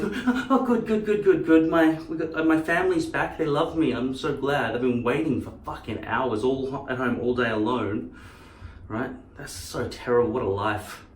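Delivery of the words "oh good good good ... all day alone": excited dog voice. Pretend. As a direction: gasp and gush like a dog whose owners are back. Voicing a lonely dog.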